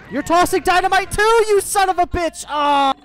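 high, loud furious yelling; outraged shout. Real, not acted. Player yelling at enemy tossing dynamite, outraged. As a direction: scream at the attacker in outrage.

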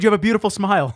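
sweet joke reply. Pretend. Joking what the child should have said, mock sweet. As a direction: coo it like a sweet little kid. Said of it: "she said".

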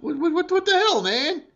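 shrill high disbelief, what the hell; exasperated outburst. Real, not acted. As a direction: voice cracks way up in outrage.

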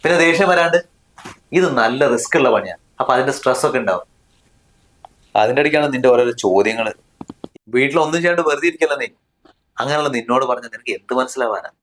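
ordinary delivery

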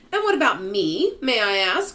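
high, loud affronted question; indignant. A narrator's reading. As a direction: butt in, put out. Miss Spider indignant at being overlooked.

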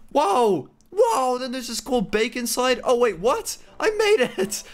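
high-pitched surprised celebration; excited amazement. Real, not acted. Player who made the checkpoint, high excited cheering. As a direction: cheer in disbelief, voice soaring.

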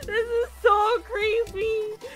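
high, slow wail through laughter; laughing horror. Real, not acted. Streamer horrified by their own creepy creation, laughing wail.

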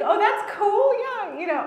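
high, loud breezy fake nonchalance; fake-casual. Covering awkwardness at a dinner with sober friends, forced breezy nonchalance. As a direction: brush it off with a bright, forced cool. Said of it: "I was like".

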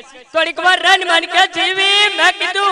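high, loud, slow wailing widow; mock wailing lament. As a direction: wail it out like a hammed-up mourner. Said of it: "રડતા રડતા કહેતા હતા".

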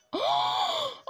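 sharp high gasp of disbelief; real startled gasp; shocked gasp. Real, not acted. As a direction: gasp as if you can't believe your eyes. Finds Julian villager, shock.

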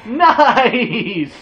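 thrilled. Real, not acted. Gamer cheers when he lands a kill, loud and high. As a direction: cheer the hit.

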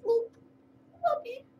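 high squeaky whoopee; playful whoopee. Acted. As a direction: cheer the cat on. Playing with the cat.

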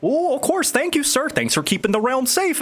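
high, folksy wizard thanks; grateful wizard. Acted. Wizard thanks party for the stick, high voice. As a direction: pitch it well up, obliging and lively.

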